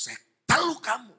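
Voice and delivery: high, loud outburst; acted forceful cry. Sorcerer recounting his attack on the preacher, loud cry. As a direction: imitate a sorcerer hurling a curse.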